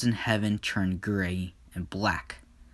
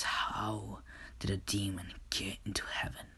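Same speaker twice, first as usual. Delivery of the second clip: surprised whisper; 2 semitones lower, 11 dB quieter than usual. Acted. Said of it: "he's just surprised".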